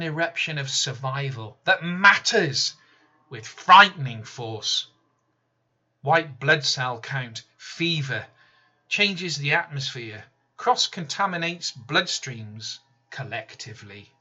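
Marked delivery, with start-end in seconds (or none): none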